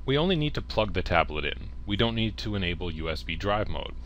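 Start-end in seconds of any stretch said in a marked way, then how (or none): none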